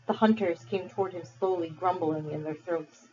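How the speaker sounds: ordinary delivery, nothing marked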